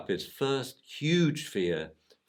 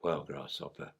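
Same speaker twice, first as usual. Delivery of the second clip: wise sensei; 6 semitones lower, 8 dB quieter than usual. Retold or imitated. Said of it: "Mister Akita, who he calls Sensei".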